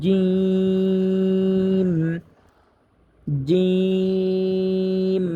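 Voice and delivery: জিম with a firm, hard j, drawn long; hard jim. Shown as a demonstration. Quran teacher demonstrating জিম for students, firm and drawn long. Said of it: "জিম হরফটা শক্ত করে উচ্চারণ করতে হবে".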